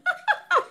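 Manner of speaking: squeaky high laugh; high giggle. Real, not acted. Reader cracking up at the imagined son, high giggle.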